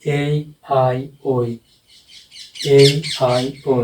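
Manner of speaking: drawn-out ए आई ओ, each vowel stretched; slow sounding-out for the class; very slow demo. Shown as a demonstration. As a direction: sound out ए आई ओ very slowly. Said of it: "लिख ही देता हूँ".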